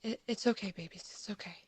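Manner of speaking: soothing hush, fading away; tender whispered reassurance; soft whispered comfort. Acted. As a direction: whisper it gently to the babies. Mother soothing children.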